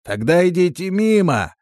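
high, loud dismissal; calling out. A narrator's reading. Voice in the cellar sending strangers away, calling out. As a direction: shout it from behind a door.

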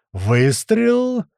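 high, drawn-out shout; shouted firing call. A narrator's reading. Gunner fires at the barn, shouting. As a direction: call the shot out long.

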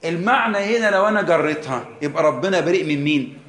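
ordinary talk

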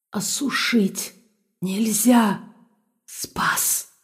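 soft inhuman whisper, fading away; eerie slow warning; eldritch horror. A narrator's reading. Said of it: "Нечеловеческий голос".

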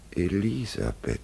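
quiet but like a cry for help; strange altered voice. Acted. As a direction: call her name softly, as if screaming inside. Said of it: "mit einer ganz anderen Stimme als sonst".

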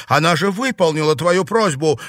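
loud, high protest; outraged exclamation; indignant. A narrator's reading. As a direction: object hotly on the girl's behalf. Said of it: "возмутилась Клариса".

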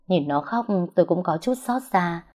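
plain reading voice

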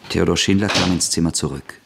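ordinary talk

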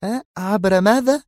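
high, questioning woman's voice; puzzled surprise. Acted. Zosha baffled by Yuri's plan, high voice. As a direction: tilt forward, confused. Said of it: "تقول في دهشة".

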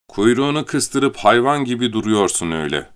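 ordinary delivery, nothing marked

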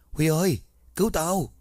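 high, panicked call while going under; desperate cry for help. A narrator's reading. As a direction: cry out for your friend in sheer panic. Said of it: "Nó kêu lên trong vô thức".

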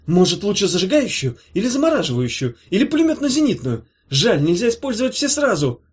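high, loud excited youngster; eager youth. Acted. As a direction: rattle off options, itching to play.

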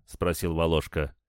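ordinary delivery, nothing marked

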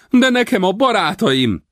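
high, loud feigned plea; mock protest. A narrator's reading. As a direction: play the loyal friend. Rawlins protesting. (színlelt tiltakozás)

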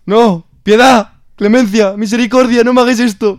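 high, loud desperate begging; pleading terror. Acted. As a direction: beg for mercy as the fire nears. Wizard begs while burned.

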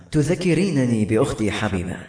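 usual speaking voice

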